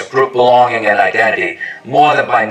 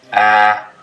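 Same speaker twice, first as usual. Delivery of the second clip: normal talking voice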